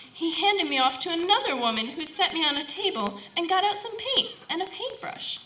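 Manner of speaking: ordinary talk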